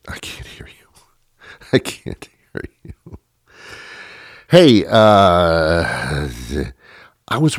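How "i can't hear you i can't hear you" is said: barely-there teasing whisper; mock whisper. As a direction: whisper it as if to nobody. Pretends not to hear audience.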